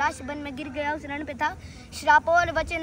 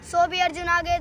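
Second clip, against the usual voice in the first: bold, full-voiced declamation; forceful recital; 2 semitones higher, 4 dB louder than usual. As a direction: declaim it like a challenge. Forceful repeat of verse. (बुलंद स्वर में चुनौती भरा पाठ)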